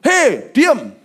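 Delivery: loud mock rebuke; retold shout. Pastor rebukes a talker, retold loud shout. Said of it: "saya pernah".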